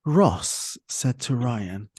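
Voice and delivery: ordinary talk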